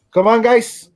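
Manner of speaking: put-on loud, high Cuban-accented wake-up call. Cuban stepmom waking kids early, loud Cuban accent. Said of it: "she sounds like a female Scarface".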